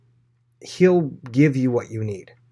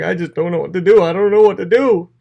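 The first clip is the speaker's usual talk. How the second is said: higher, louder, mock panic; panicked; 6 semitones higher, 7 dB louder than usual. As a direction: flap like someone in a hammed-up panic. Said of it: "the people who are panicked".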